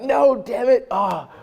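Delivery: high-pitched, hammed-up exasperation; pissed off. Retelling his reaction to a fan, pissed off. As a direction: whine it high in mock despair. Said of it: "I'm so pissed off".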